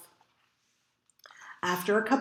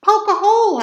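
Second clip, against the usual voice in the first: high, louder order; yelled command; 10 semitones higher, 8 dB louder than usual. A narrator's reading. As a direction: yell it at the rhino. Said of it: "I yelled".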